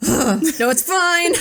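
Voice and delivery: sigh, then high strained reassurance; forced fine. Retold or imitated. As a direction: swallow irritation and squeak agreement. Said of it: "You can just see it in his eyes".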